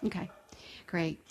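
normal talking voice

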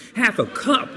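high, amazed echo. Retold or imitated. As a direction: marvel at the genius of it. Amazed repeat.

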